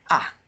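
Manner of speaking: "Ah" falling sharply from high to low; short, falling "Ah"; fourth tone. Shown as a demonstration. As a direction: drop "Ah" quickly from the top. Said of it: "high to low".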